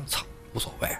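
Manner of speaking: breathy unvoiced whisper, offhand; whispered shrug. Lei Heng shrugging off the guards, in a whisper. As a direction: toss it away under your breath.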